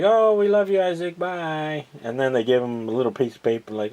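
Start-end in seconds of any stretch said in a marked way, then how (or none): 0.0-1.8 imitating kids. Kids saying goodbye to Isaac, high mock-childish voice. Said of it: "the kids are like".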